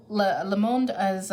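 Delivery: Le Monde said the French way, slowly; slow, careful French Le Monde; French pronunciation. Shown as a demonstration. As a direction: say Le Monde as a French speaker would. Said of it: "I had to put in the accent there".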